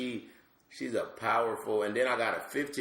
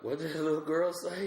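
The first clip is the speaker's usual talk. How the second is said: crowd puzzled; 9 semitones higher than usual. Retold or imitated. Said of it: "the crowd is like".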